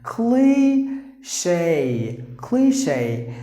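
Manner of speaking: Cliche broken slowly into klee-shay, then whole; careful pronunciation. Shown as a demonstration. Teacher modelling Cliche for learners, slow long sound. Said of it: "how you have to pronounce the word".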